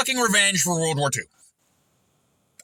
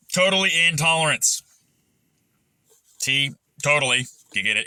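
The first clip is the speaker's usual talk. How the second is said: normal talking voice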